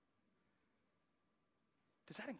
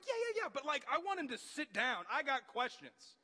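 much higher and louder, insistent; eager pleading; 10 semitones higher, 6 dB louder than usual. Retold or imitated. Believer pleading with God over morning coffee, eager. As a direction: demand God sit down with you.

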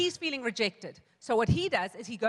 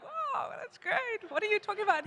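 mock puzzled delight; 9 semitones higher than usual. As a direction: play the baffled crowd, a touch sarcastic. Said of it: "And we were all like".

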